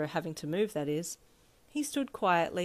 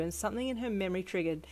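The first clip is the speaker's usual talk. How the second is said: usual speaking voice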